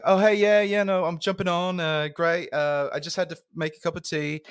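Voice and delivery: put-on high-pitched English-accented teammate. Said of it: "I liked your English accent".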